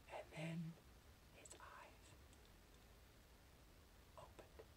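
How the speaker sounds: barely audible whisper with long pauses; almost silent, halting reveal; suspenseful whisper. Acted. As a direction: breathe it out word by word. Suspense before the climax.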